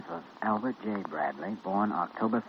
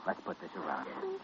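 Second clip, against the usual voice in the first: soft, tender reassurance; gentle; 5 semitones lower, 9 dB quieter than usual. Acted. Doctor to a shivering girl, soft and tender. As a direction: soothe a frightened girl.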